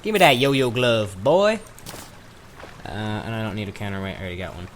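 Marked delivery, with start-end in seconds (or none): 0.0-1.6 a cocky Southern trader showing off his gear; southern accent. Pretend. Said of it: "that southern accent".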